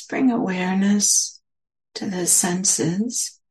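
unhurried meditation guidance with long pauses; guided meditation. Real, not acted. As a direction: gently guide listeners inward, leaving space between phrases.

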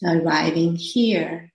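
slow phrases with long pauses; spacious, measured meditation guidance; guided meditation. Acted. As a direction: gently guide listeners into settling, unhurried.

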